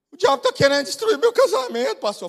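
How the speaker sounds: high, hurried mock wail; lightly hammed-up tearful complaint; imitated crying woman. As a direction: act out a distraught wife pleading. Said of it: "E ela chorando".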